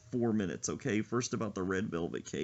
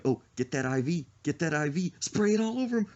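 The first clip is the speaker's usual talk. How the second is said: hyped gamer; 5 semitones higher than usual. Pretend. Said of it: "People would be like".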